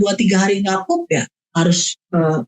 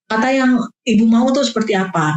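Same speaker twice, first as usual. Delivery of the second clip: ordinary delivery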